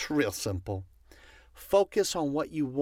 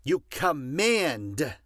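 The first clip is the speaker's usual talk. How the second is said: usual speaking voice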